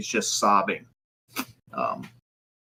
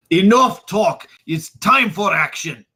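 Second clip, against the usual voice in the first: loud, higher angry shout; furious outburst; 7 semitones higher, 7 dB louder, slower than usual. Acted. Boris shoving Piltrup aside, shouting loud and high. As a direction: bark it out, done talking.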